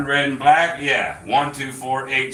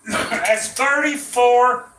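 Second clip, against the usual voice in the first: laughing; 10 semitones higher, much slower than usual. Real, not acted. Laughing at the huge bet.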